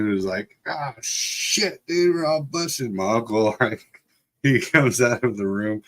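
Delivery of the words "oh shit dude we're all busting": higher-pitched mock "we're caught"; imitated dread. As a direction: retell the sinking realization, acted lightly. Kids realizing they are caught.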